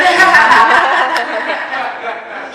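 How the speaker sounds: a sharp, high, loud laugh. Real, not acted. Laughing after the laundry door joke. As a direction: burst out laughing at your own joke.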